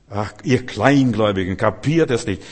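loud, exasperated mock rebuke; scolding. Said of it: "schalt ihren Kleinglauben". (laut tadelnd, gespielt genervt)

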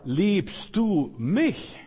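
slow tender searching question. Acted. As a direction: draw it out gently, longing for an answer. The Lord asking Peter.

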